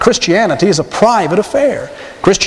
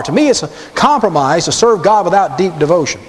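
usual speaking voice

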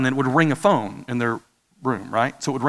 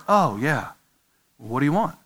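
half-asleep wife; 4 dB quieter than usual. Retold or imitated. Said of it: "She was sleeping".

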